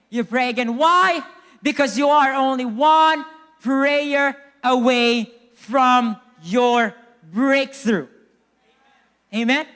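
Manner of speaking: loud, rising to a drawn-out climax; building preaching. Real, not acted. Preacher urges church to pray again, preaching builds to a shout. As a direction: build the repeated urging, then stretch every word.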